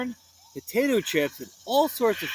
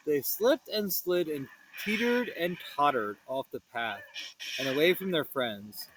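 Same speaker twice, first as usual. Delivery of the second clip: usual speaking voice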